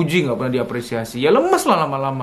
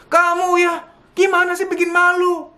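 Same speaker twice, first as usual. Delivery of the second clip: mock scolding, much higher, accusing; hammed-up nagging mother; shrill scolding parent. Parent berating a child who reports trouble, mock high scold. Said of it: "Nunjuk aja gitu".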